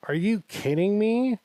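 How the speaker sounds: high-pitched exasperated question; can't-believe-this outburst, acted out; retold incredulity. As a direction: voice leaps up in mock disbelief. Storm rolls in, retold exasperation.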